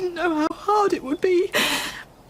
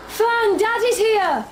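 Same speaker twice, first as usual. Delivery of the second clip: calling out; 7 semitones higher, slower than usual. Acted. Father calling for daughter.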